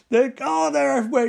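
high, loud mock panic; overacted victim overrun by insects; Nicolas Cage. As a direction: cry out in hammed-up horror. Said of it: "he does his best Nicolas Cage".

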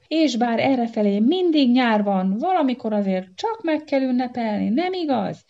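high, loud rally voice of a monkey boss; monkey chief voice. Acted. Monkey chief rallying his guests, high and loud. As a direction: whip up the crowd like a jolly monkey boss.

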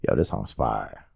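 low, slow, hushed approval; won-over listener. Retold or imitated. Host imitating a converted listener, low slow awe. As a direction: drop it deep and slow, impressed. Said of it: "you're like".

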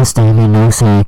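very high, very loud mock scream. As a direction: scream it like a maniac. Screaming insult in put-on voice.

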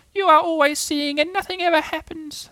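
very high, squeaky little complaint; small creature's disappointed squeak; Roo, sad. Acted. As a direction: lament in a tiny piping voice. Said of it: "said Roo sadly".

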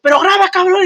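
high, loud, urgent demand; imitated yell. Arrested friend orders his buddy to record, yelling. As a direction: mimic a friend hollering an order.

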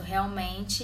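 normal talking voice

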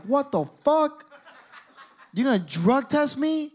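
high, loud disbelief breaking toward laughter; incredulous shock. Retold or imitated. Teen reacting to drug test threat, high incredulous outburst. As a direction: squeal it in comic outrage.

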